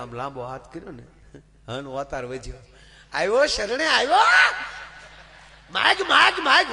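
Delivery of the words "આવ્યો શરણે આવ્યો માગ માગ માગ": much higher and louder, laughing grandly as a deity; booming goddess voice. Acted. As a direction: proclaim the boon like a triumphant goddess. Goddess grants a boon.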